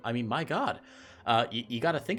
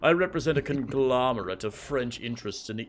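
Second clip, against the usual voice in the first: put-on light British accent villain line; a lightly voiced British villain trailing off. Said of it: "I don't know why he has a British accent".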